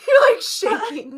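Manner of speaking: laughing hard, high and loud. Real, not acted. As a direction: squeal it through laughter. Laughing about nervous moment.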